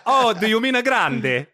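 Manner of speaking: high, affected Italian "grande"; mock Italian barista. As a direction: parody a precious Italian-speaking barista. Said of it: "you were that guy".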